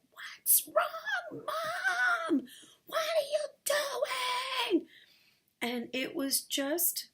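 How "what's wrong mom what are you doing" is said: very high, strained doggy howl of words; barking dog. Acted. Dog to its screaming owner on the floor, high frantic barking voice. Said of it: "this dog was barking at me like".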